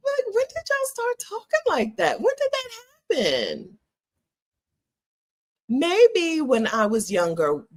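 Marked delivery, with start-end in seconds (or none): 0.1-3.8 much higher, disbelieving plea; exasperated. Real, not acted. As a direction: throw up your hands, pitched way up. Exasperated at younger men's voices.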